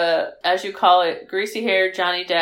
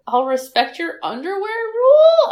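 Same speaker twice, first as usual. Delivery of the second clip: mock boyfriend; 9 semitones higher, 4 dB louder than usual. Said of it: "then he's like".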